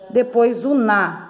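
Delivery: usual speaking voice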